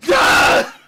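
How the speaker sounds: high, airy voiceless shriek; an unvoiced, high-pitched yell; breathless scream. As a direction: shriek with no voice behind it. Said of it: "as I'm screaming".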